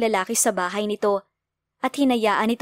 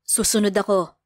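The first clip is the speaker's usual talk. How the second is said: emotionless. A narrator's reading. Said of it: "Walang emosyon itong sabi".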